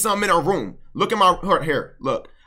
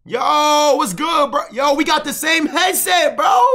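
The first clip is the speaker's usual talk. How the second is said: high-pitched, excited greeting; demo opener; 10 semitones higher, 3 dB louder, slower than usual. Shown as a demonstration. Coach demonstrating how to start a convo, excited. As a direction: hail him like a thrilling coincidence, drawn out. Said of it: "Watch how I easily fry you".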